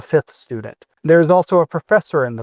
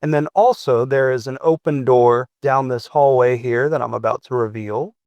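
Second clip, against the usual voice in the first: ordinary talk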